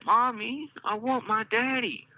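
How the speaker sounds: put-on high child voice near tears; tearful little girl. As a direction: whine for daddy, about to cry. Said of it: "tears welling up".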